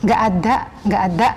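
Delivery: low, fast Javanese "nggak ada"; Javanese medok accent. Retold or imitated. Teacher imitates Javanese speech for students. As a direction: mimic the Javanese medok lightly. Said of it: "Orang-orang Jawa kan gitu ngomongnya".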